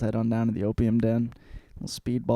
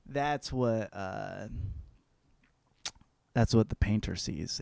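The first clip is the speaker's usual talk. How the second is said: ordinary talk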